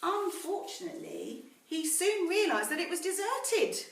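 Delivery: story narration. A narrator's reading. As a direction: read it like a bedtime story to the class. Teacher reads story to class.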